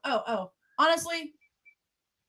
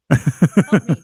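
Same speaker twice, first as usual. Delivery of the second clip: lower, louder playful cry for help; mock captive plea; 7 semitones lower, 11 dB louder than usual. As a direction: ham up a hostage begging for help. Mock sign language joke, captive.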